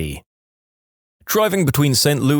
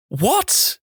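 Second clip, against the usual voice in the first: high-pitched shocked question; panicked disbelief; 12 semitones higher than usual. Acted. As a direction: let the pitch leap in alarm. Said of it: "I'm panicking now".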